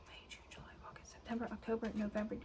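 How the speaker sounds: whispered count of months; counting under breath. Real, not acted.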